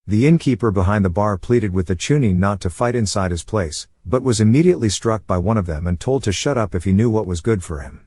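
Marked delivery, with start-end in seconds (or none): none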